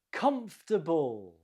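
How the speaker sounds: "Comfortable" with the first syllable stressed, the rest reduced. Shown as a demonstration. Teacher models the word in a pronunciation round, first-syllable stress on "Comfortable". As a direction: model "Comfortable" as three syllables, COMF-ta-ble. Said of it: "The stress is on the first syllable".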